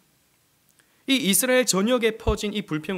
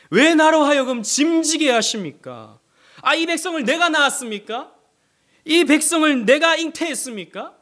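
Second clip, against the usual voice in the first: raised, anguished complaint to God, higher and louder; Moses complaining; 8 semitones higher, 8 dB louder than usual. Acted. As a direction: plead and protest like a worn-out leader. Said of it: "라고 모세가 이렇게 불평하고 있습니다".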